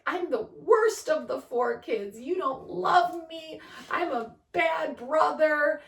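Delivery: put-on high, slow whiny kid voice; crying child. Mom imitating her crying son, high and slow. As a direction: whimper it like a hurt little boy.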